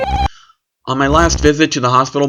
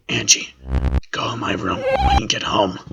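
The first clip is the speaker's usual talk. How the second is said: hushed brother; 6 dB quieter than usual. Acted. Brother urgently tells sister.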